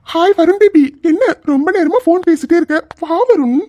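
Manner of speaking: teasing a friend on the phone in a woman's voice; ladies' voice. Acted. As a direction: coo his name like a flirty woman. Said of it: "இப்ப எதுக்குடா நீ ladies வாய்ஸ்ல பேசிட்டு இருக்க?".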